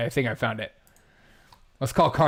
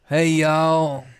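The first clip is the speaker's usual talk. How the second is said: lightly put-on southern stoner; drawn-out southern stoner greeting; southern accent, lazily stretched out; 4 dB louder than usual. Shown as a demonstration. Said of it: "a stoner southern accent".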